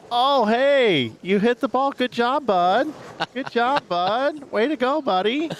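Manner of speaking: put-on high, sing-song praise like to a toddler; talking to a little kid. Big brother praising his brother like a toddler, high and sing-song. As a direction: coo praise at him like he's a small child. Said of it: "Like it's a little kid".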